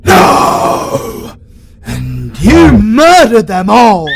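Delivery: ordinary delivery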